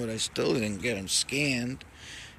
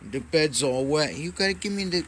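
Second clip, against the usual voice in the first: usual speaking voice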